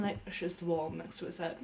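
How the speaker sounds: ordinary talk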